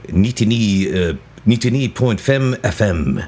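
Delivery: put-on a light Norwegian radio announcer accent, slowed; imitating Norwegian radio. DJ imitating Norwegian radio he heard in Oslo, light Norwegian accent. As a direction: mimic a Norwegian DJ reading the frequency. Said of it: "they would go like".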